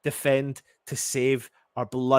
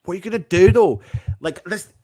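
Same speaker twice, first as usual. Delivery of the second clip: high, loud demanding yell; exasperated shout; 6 semitones higher, 7 dB louder than usual. Real, not acted. Fan shouting at his two-nil-down team, exasperated. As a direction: yell at them to react.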